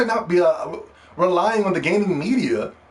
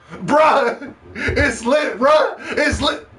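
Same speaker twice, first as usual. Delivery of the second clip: high, laughing fanboy imitation; mock fanboy hype; 10 semitones higher, 4 dB louder, much slower than usual. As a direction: parody their excitement, pitch shooting up. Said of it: "This is how they talk".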